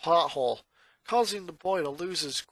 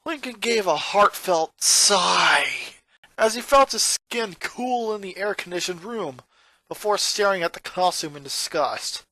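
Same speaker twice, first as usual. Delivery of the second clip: ordinary delivery, nothing marked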